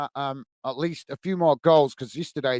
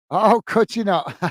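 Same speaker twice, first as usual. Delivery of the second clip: laughing; 6 semitones higher, 5 dB louder than usual. Real, not acted. Laughing at snow excuse.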